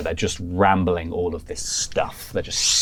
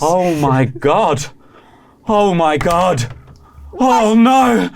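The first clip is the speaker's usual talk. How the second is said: slow, high and louder, rising alarm; panicked gasp; 10 semitones higher, 6 dB louder, much slower than usual. Acted. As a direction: voice Charlotte's dawning horror. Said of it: "Charlotte go".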